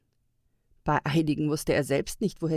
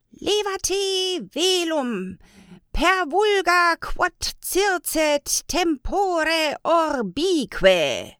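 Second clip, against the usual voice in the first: high, slow, loud chanted spell; hoarse called-out incantation; 12 semitones higher, 6 dB louder, much slower than usual. A narrator's reading. Old elf casting a spell over a snow globe, hoarse slow call. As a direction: call out the spell, drawing out each word in a raised rough voice. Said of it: "rief er mit rauer Stimme".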